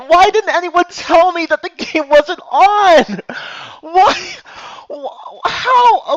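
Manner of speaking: high, loud shrieking what, what; shocked disbelief. Real, not acted. Streamer finding the game wasn't on, shrieking in shock. Finds game capture was off.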